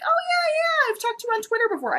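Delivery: very high, quick imitation of an author; high-pitched put-on gush; imitated excited recognition. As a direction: mimic someone suddenly remembering a fan. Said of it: "she was like".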